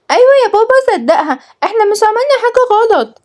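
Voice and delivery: put-on very high little-kid voice; small child. A narrator's reading. Little child backing Nour to father, high-pitched kid voice. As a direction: pipe up like a tiny child.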